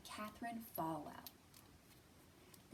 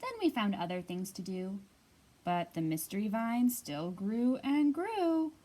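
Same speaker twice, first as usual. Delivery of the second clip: louder, lively storybook read-aloud; reading to children; 10 dB louder than usual. Acted. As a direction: read it out big and bright for a circle of kids. Reads picture book aloud.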